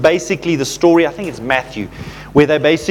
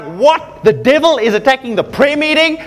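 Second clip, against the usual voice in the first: indignant; 5 semitones higher than usual. Retold or imitated. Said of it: "I got angry".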